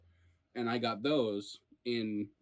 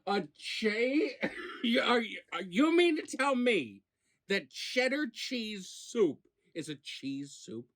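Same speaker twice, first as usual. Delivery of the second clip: high, disbelieving, laughing through it; incredulous; 8 semitones higher than usual. Real, not acted. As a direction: act shocked and amused. Incredulous reaction to cheese soup.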